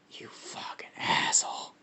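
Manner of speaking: soft, husky creature snarl; whispered monster curse. Acted. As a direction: curse in a breath-heavy monster voice. Acted bickering between the two symbiotes.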